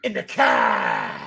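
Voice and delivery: very low, slow Boston drawl; hammed-up deep Boston accent; bad Boston accent. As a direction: drag car out low as "cah". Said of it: "saying things in a bad Boston accent".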